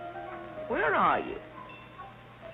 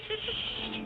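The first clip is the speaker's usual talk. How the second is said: shushing; 10 semitones higher, 4 dB quieter, much faster than usual. Acted. Shooing pixies quietly.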